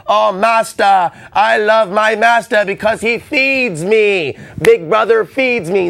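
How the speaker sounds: high, loud, slow fawning pet voice; a dog fawning over its master, high and slow; mock adoring dog. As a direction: simper like a grateful dog to its master. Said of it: "A dog that loves his master".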